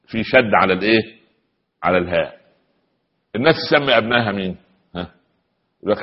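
ordinary delivery

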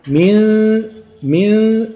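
"من" held on a nasal hum, stopping short of the next letter; "من" with the tongue poised for a seen, not yet sounding it; ghunna before the letter. Shown as a demonstration. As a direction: hum through the noon of "من" and leave it hanging. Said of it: "انا جبت السين لسه خالص".

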